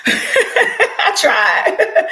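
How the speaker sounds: high, loud laugh through the words; cracking up at your own attempt. Real, not acted. Host owning up to running out of breath, laughing.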